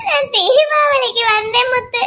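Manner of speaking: sobbing lament with a broken voice; crying. Acted. Grieving daughter calls to her friend, sobbing. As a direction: wail out the loss of her father through tears. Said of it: "நீ அழாத please".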